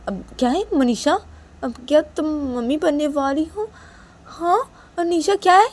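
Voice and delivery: loud, high, stammered questioning; stunned disbelief. A narrator's reading. Father questions Nisha about the baby, stunned. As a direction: rise into astonished questions. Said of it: "हैरान से देख रहे थे".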